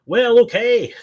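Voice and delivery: high, loud mock Scottish accent; bad Scottish accent. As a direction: attempt a hammy Scottish accent. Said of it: "That was the worst Scottish accent ever".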